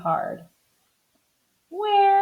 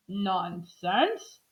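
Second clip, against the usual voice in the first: softer, hesitant dismissal; unsure, frightened; 9 dB quieter than usual. A narrator's reading. Mister Watson answering his panicked wife, unsure and softer. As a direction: say it quietly, doubting yourself. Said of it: "he did not sound very sure".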